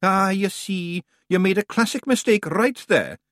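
easy, offhand brogue; conversational Scots. A narrator's reading. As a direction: Rob explains casually, in Scots. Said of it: "said Rob conversationally".

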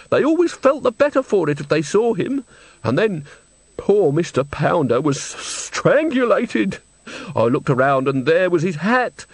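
put-on high, distressed old woman's voice; tearful old woman. A narrator's reading. Old woman recounts the death to Nanny, tearful. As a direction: tell it upset, pitch leaping up.

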